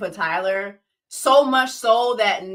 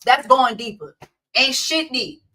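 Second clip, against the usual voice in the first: usual speaking voice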